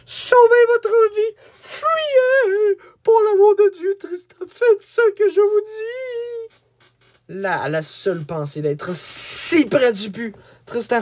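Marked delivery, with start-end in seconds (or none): 0.0-7.4 very high, wailing shout; shrieked warning in a high put-on voice, long drawn ending; desperate cry. A narrator's reading. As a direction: cry out a frantic plea from afar. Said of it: "il cria".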